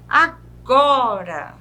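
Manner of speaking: "Agora" slow, GO long and strong; penultimate stress. Shown as a demonstration. Teacher listing examples, "Agora" drawn out on GO. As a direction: linger on the go of "Agora". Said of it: "a sílaba mais forte".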